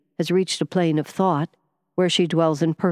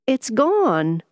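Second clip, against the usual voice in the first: high, louder burst of wonder; amazed relief; 8 semitones higher, 4 dB louder than usual. A narrator's reading. Harland feeling his pain vanish, amazed high relief. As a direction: let it out, hardly believing it.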